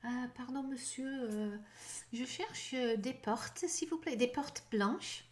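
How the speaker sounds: tentative polite request in French; acted French conversation. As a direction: play a softly spoken shopper feeling for the French words. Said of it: "Apply your new word in a conversation".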